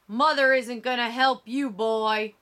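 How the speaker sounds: mock the boy's cry; cruel mockery. A narrator's reading. Killer mocking screaming Emmett.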